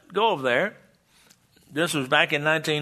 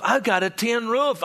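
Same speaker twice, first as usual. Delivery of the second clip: raised, eager retelling of a friend's pride; excited boast; 8 semitones higher than usual. Retold or imitated. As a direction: show off the new tin roof, building. Pastor retells Joseph's excited boast.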